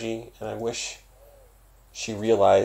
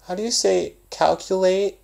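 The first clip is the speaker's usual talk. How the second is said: imitating a kid asking; 12 semitones higher, 3 dB louder than usual. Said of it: "and then there's the babies".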